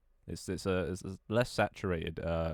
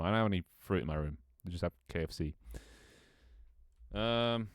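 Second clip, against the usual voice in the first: ordinary delivery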